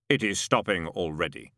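deep calm of an immortal; voice of Death. A narrator's reading. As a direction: speak as the ancient angel of death, unhurried. Death answers Adam.

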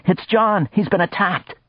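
high, breathless alarm; agitated. Acted. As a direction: blurt the bad news in panic. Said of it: "never seen the little man so agitated".

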